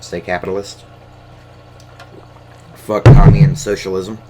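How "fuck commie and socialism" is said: shouted curse, louder; sudden loud rant; loud outburst. Real, not acted. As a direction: blurt it out angrily. Ranting against communism on camera.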